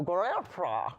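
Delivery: Gaelta mumbled as if dentures were out; mock toothless mumble. Joking about dentures, says Gaelta in a mock toothless mumble. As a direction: say Gaelta gummy, with no teeth in. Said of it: "You just take 'em out and go".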